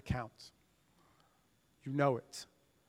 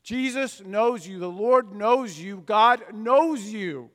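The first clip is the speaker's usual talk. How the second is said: slow, louder each time, stress on knows; building emphasis; 4 semitones higher, 9 dB louder, slower than usual. Acted. Preacher drives the refrain at the congregation, slow and building. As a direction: hammer the refrain home, slower and louder.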